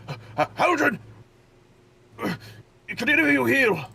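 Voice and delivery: high, loud, alarmed call for help; a guard yelling in panic; panicked guard. Acted. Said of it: "the guard, uh, next to him be like".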